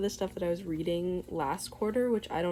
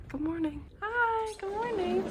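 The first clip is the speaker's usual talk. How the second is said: bright greeting; 12 semitones higher than usual. Real, not acted. Cheery greeting to camera.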